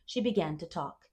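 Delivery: ordinary delivery, nothing marked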